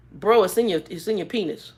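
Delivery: ordinary talk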